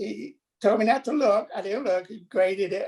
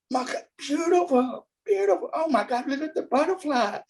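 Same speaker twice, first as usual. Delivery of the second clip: emotional awe; 5 semitones higher than usual. Retold or imitated. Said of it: "he was emotional".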